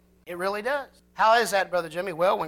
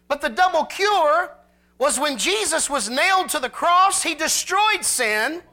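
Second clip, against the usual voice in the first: higher, louder preaching build; preaching at full force; 8 semitones higher, 5 dB louder than usual. Acted. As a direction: drive the point home from the pulpit.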